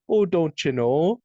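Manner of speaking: slow it down like teasing a Canadian; mock Canadian accent. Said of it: "the first Canadian that they played with".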